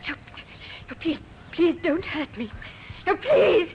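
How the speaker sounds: panicked pleading that builds to a scream; terrified woman begging, rising to a scream. Acted. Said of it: "A woman screamed and cried for help".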